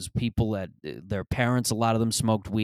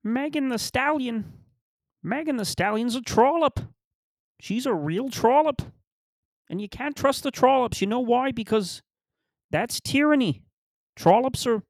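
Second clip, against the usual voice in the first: very high, strained mock-lecturer voice; guru caricature; 3 dB louder than usual. Comic as moralizing guru condemning a rapper, high-pitched caricature. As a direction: pronounce judgement in a squeezed falsetto. Resumes the Peterson impression bit.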